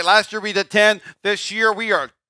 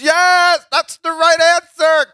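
high, loud shout of triumph; exultant professor; 11 semitones higher, 7 dB louder, slower than usual. Retold or imitated. Professor hears Jeremy's right answer, exultant shout. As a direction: burst out loud and high, drawing it out.

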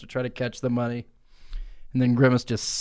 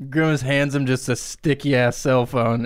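usual speaking voice

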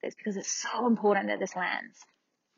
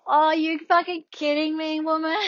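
higher, louder incredulous protest; mock outrage; 8 semitones higher, 5 dB louder than usual. Patient reacting to midwife's slip, mock outrage. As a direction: act out exasperated disbelief.

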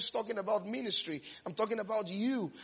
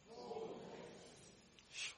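whispered word; 19 dB quieter than usual. Real, not acted. Tell somebody, boldness.

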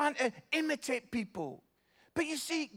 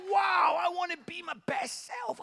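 excited realisation; 6 semitones higher than usual. Acted. Voicing an inspired believer's reaction.

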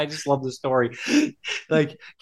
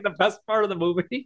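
ordinary delivery

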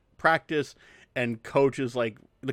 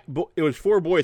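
ordinary delivery

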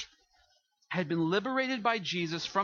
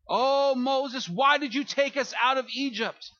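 higher, louder complaining cry to Moses; whining Israelites; 5 semitones higher, 5 dB louder than usual. Acted. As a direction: wail it like a grumbling crowd in the desert.

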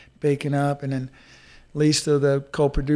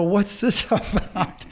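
mock-baffled, high drawn-out question; retold disbelief; 6 semitones higher, much slower than usual. Racer discovering the pressure washers after creek washing, mock disbelief. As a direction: act out being dumbfounded, pitched up.

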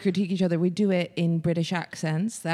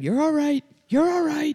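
high mock yell of reassurance; a mock screamed reassurance; retold scream; 11 semitones higher, slower than usual. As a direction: shout it up high, half joking. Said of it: "you screaming at him".

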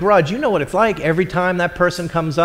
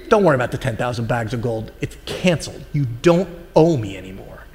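gentle forgiveness; 7 semitones lower, 5 dB quieter than usual. Acted. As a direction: lower and softer, kind master letting it go. Master gently forgiving the debt.

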